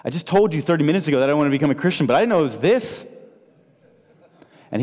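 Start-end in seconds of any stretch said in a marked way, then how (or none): 0.0-3.1 fast, higher, astonished rush; amazed. Retold or imitated. New convert marvelling at what Christianity is, higher and faster. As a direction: blurt it out in disbelief.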